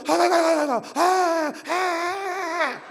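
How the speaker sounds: hammed-up groaning, stretched and fading; milk the aches for sympathy; feigned groans of pain. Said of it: "아프다고 하면 가방 들어줘". (엄살 부리는 신음)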